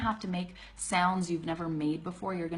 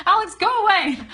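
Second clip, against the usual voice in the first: laughing mock dismissal; 13 semitones higher, 11 dB louder, faster than usual. Real, not acted. Teasing a friend who is watching.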